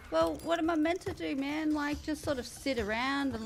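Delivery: high-pitched, protesting comeback; indignant retold protest; exasperated retort. Retold exasperated reply in argument.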